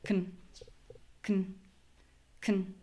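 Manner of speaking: "Con" as a quick, soft k'n with no vowel; k and n together. Shown as a demonstration. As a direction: squeeze "Con" down to k plus n. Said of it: "the sound k and the sound n together".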